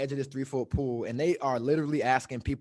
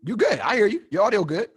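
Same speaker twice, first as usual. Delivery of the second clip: checking the call; 6 semitones higher, 4 dB louder than usual. Real, not acted. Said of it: "It froze up".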